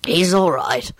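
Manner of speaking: understated choked-out reply. A narrator's reading. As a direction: get it out as if choking. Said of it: "Lupin choked out".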